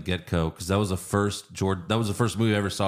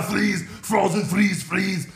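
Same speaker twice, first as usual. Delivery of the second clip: Arnold mimicry; 9 semitones higher, slower than usual. Comic mocking the casting of Arnold, hammed-up high shout. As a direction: shout it as a hammed-up action-star villain.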